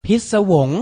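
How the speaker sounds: พิศวง said clearly and loudly as phit-sa-wong; pronunciation model. Shown as a demonstration. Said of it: "ออกเสียงพร้อมๆพี่ไทยให้ถูกต้อง".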